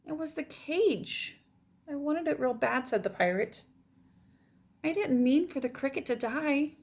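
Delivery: gentle expressive read-aloud; story narration. Acted. Teacher reading the ending of the story to her class.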